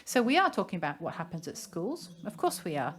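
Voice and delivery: ordinary delivery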